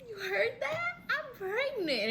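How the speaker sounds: very high, drawn-out delight; thrilled. Retold or imitated. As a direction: marvel at it in a high squeal. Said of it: "I was so happy".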